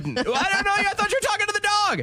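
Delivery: very high, fast put-on voice; mock high reply. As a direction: squeak out the excuse in a silly high voice. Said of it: "I'm like".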